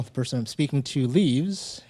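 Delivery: normal talking voice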